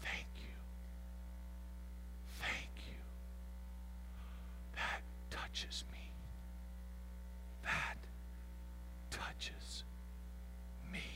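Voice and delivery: soft, breathy whisper of thanks; God whispering. Acted. God whispering thanks to the confessor, tenderly. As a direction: hush it as if deeply touched. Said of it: "God goes, "Oh".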